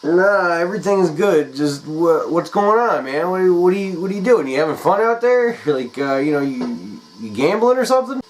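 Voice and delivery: high, loud fake-casual voice; nervous caller. Pet sitter on the phone to Jim, high fake-casual cover. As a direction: cover panic with a breezy tone.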